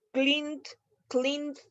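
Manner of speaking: "cleaned" tried out several ways, as clin, clint and clinet; testing out "cleaned" in different versions, one after another; trying pronunciations. Shown as a demonstration. Teacher trying out versions of "cleaned" for students, testing each one. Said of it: "¿cómo lo voy a pronunciar?".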